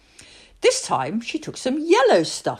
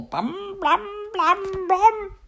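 knocking sound; 13 semitones higher, much slower than usual. Acted. Knock at the door.

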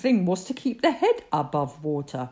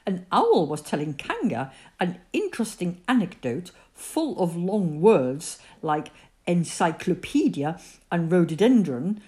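plain reading voice

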